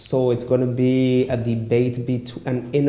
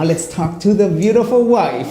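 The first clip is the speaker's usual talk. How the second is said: laughing; 7 semitones higher, 4 dB louder than usual. Real, not acted. Host laughs introducing guest.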